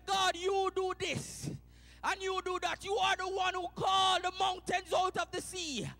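loud, high, rousing praise preaching; shouted praise. Acted. Habakkuk praising God, preached at full force.

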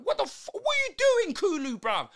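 high, loud disbelieving yell; shocked outburst. Real, not acted. Shock at a player's action.

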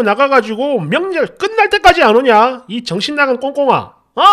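hammed-up raging husband on the phone; imitating husband's rage. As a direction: rant at her like a furious husband.

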